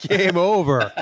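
echoed through laughter; laughing while repeating the line; real laugh breaking the words. Real, not acted. Echoes the quote, cracking up.

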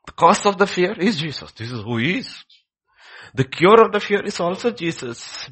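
high, very loud proclamation; full-force preaching. Real, not acted. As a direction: thunder the point out to the congregation.

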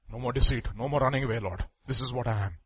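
low, soft acted prayer; hushed penitent surrender; quiet confession. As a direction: confess humbly under your breath to God. Voices a sinner confessing to God.

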